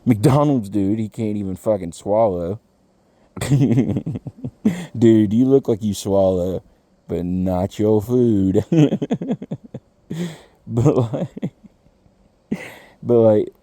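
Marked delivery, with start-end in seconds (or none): 3.4-4.9 a short burst of genuine laughter; real laugh. Real, not acted. Host laughs at his own McDonald's joke, real laugh. 8.7-9.8 a rising real laugh; higher laugh. Real, not acted. Host laughs at his own swallowing joke, high real laugh.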